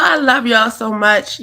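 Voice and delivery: ordinary delivery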